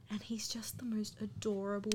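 whispered, soft and adoring; whispering. Real, not acted. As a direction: breathe it out in a gushing whisper. Gushing about the actor's sweetness.

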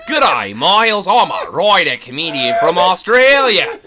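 mocking the email in an overdone Australian accent; scoffing at the scam email in a loud mock Australian accent. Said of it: "No one types good day".

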